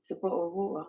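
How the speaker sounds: British bottle of water, with a dropped t; British accent. Shown as a demonstration. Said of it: "because of the differences".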